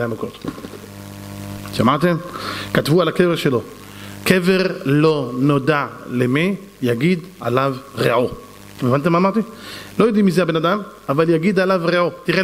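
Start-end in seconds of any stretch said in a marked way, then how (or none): 4.2-8.3 slow, low and deliberate; measured, weighty low recital; solemn inscription reading. Retold or imitated. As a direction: read it out word by word like an epitaph. Reads out the gravestone inscription.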